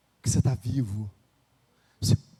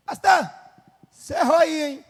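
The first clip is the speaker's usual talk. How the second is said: mock scolding sister; 8 semitones higher, 5 dB louder than usual. Sister scolding pastor, acted.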